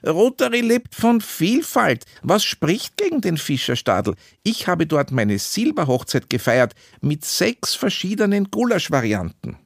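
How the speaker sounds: high-pitched, insistent protest; indignant objection. A narrator's reading. Club member defending his favourite venue, indignant objection. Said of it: "ließ das nicht gelten".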